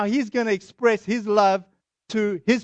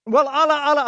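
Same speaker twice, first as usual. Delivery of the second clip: higher, cutting in insistently; defensive; 6 semitones higher, 4 dB louder, faster than usual. Retold or imitated. Shop owner interrupting the visitor's prayer offer, defensive. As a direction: try to break in with an objection. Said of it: "he's like getting defensive".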